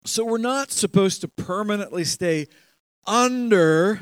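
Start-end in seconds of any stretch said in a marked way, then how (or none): 3.1-4.0 "under" hit loud and high; "under" punched out on its own; emphasis on under. Real, not acted. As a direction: lean hard on "under", raising pitch and volume. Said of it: "I'm emphasizing the word".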